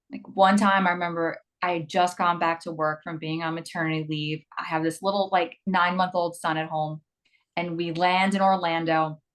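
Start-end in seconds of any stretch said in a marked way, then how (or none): none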